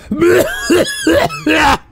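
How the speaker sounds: high, loud gagging heaves; noisy dry heave; retching cough. Real, not acted. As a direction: retch as if throwing up. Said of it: "I almost wanna throw up".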